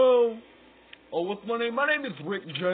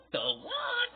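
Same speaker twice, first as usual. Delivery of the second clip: high mock shriek. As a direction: voice shoots up into a squeal on what. Repeated in silly voices.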